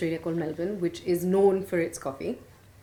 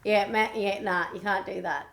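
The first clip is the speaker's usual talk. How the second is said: put-on strong Aussie accent; broad Australian accent. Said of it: "I'm talking in a very strong Australian accent".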